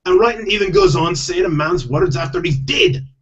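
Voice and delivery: hammed-up Scottish husband, reluctant; Scottish accent. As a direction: complain in a put-on Scottish accent. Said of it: "I do have to do Scottish accents".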